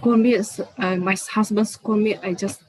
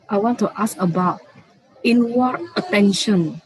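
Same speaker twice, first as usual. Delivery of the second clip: speaking up; 3 semitones higher, 5 dB louder than usual. Real, not acted. Student repeating the question for the teacher, speaking up. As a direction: raise your voice to be heard. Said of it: "Can you speak a little bit louder?".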